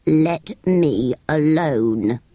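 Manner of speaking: slow, husky sand-creature voice; dry husky creature. Acted. Sand fairy grumbles from the sand, slow husky voice. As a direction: drag it out grumpily from the sand. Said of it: "dry, husky voice in the sand".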